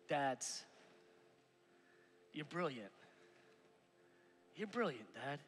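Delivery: soft, low and sincere; tender reassurance, almost hushed; heartfelt encouragement. Real, not acted. Pastor sincerely encouraging fathers from the heart, softly. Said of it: "from the bottom of my heart".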